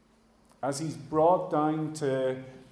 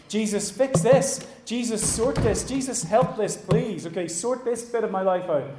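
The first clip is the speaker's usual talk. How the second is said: insistent, higher-pitched pleading; urgent demand; 6 semitones higher, 4 dB louder than usual. Acted. Believers bringing their troubles to Jesus, urgently demanding. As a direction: press Jesus to sort it out.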